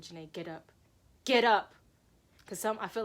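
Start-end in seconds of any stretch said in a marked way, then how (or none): 0.0-0.7 low, soft command to oneself; subdued inner order; quiet self-urging. Retold or imitated. Tells herself to get up. 1.2-1.7 loud, punched command; sudden raised-voice command; forceful self-urging. Retold or imitated.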